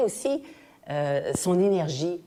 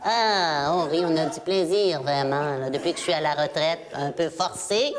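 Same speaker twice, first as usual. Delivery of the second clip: nasal, gushing politician caricature; Pauline Marois. Acted. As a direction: play a delighted retired politician, nasally. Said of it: "Alors que Pauline Marois".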